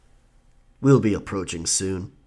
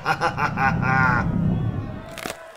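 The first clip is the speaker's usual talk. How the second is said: reckless battle laugh, drawn out; wild laugh; 3 semitones higher than usual. Acted. Pirate laughing madly while fighting the Kraken, wild laugh. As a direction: laugh into the storm.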